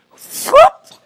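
very high, loud whoop as curtains fly open; mom's sudden yank of the curtains; imitated whoop. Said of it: "She'd take the curtains and go".

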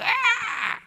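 sudden high-pitched mock shriek; a startled yelp, like something scalded. Preacher acting out Satan fleeing the truth, high shriek. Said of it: "Satan went".

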